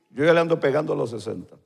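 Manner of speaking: low, quick, quiet aside; musing aside. Real, not acted. Preacher repeats his age to the congregation, quiet musing. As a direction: drop it softly, almost to yourself.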